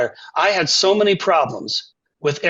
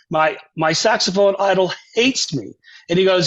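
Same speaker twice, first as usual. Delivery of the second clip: usual speaking voice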